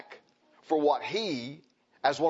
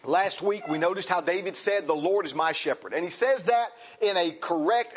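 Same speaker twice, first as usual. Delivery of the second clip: raised, ringing preacher voice, higher pitch; fervent preaching; 7 semitones higher than usual. Acted. Pastor preaching to the congregation, fervent and raised. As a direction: preach it out with full conviction. Preaching at full force on the psalm.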